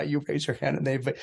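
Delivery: ordinary delivery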